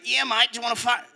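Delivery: a hint of a friendly Australian bloke; Australian accent. Australian dude offering a pint, mild Australian accent. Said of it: "he's like".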